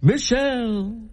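high, slow ceremonial introduction; mock announcer. Co-host introduces the queen to the crowd, mock announcer. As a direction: draw out a grand royal announcement.

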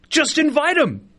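exasperated high plea; emphatic plea. Real, not acted. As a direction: plead it like it's obvious. Repeats plea, higher and slower.

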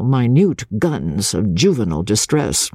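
ordinary delivery, nothing marked

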